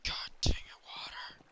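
unvoiced, hissed frustration; whispered curse. Real, not acted. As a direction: whisper the curse through your breath. Muttering at the water.

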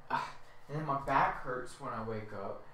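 usual speaking voice

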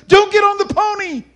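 high-pitched, loud panicked retelling; yelled warning. Retold or imitated. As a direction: yell it like a frightened kid. Boy yells the warning to brother.

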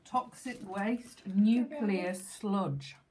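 very slow, drawn-out words; slow dramatic. Real, not acted. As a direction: stretch each word out.

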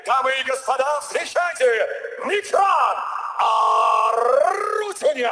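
big booming ring announcer shout; ringmaster. Real, not acted. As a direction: roar the name to the arena. Ring announcer introduces fighter.

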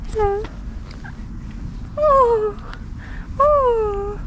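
high, soft hello to a cat; high tender coo to a stray cat. Real, not acted. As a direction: croon a greeting. Cat talk.

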